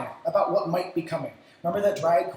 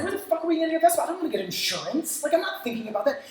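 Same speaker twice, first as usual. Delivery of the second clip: panicked client; 8 semitones higher, much faster than usual. Retold or imitated.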